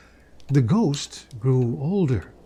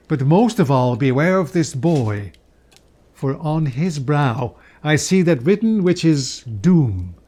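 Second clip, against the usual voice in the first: louder, grave spirit's warning; solemn warning ghost; 3 dB louder than usual. Acted. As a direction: warn of doom with weight.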